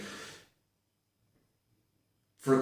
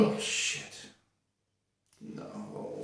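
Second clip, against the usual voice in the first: breathy horrified whisper; whispered dread; 10 dB quieter, much slower than usual. Real, not acted. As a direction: whisper it in dismay. Dread at Fifty Shades.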